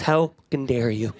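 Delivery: "How fucking dare you" said low with "fucking" silenced; muted swear word. Retold or imitated. Indignant mother who won't swear, "fucking" silently swallowed. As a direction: leave a silent hole where "fucking" goes, then "dare you". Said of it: "She mutes herself".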